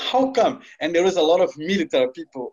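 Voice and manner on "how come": surprised. Retold or imitated. Shocked the flight is cancelled.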